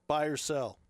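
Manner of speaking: usual speaking voice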